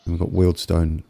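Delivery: ordinary talk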